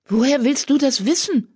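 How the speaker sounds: high, indignant challenge; Blaustern protesting. A narrator's reading. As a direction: throw the question back in outrage. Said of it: "protestierte Blaustern".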